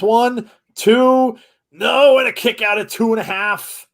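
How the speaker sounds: excited live ring call; wrestling commentator. Acted. Live play-by-play of the match.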